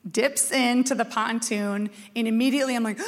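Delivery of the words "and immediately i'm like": ordinary talk, nothing marked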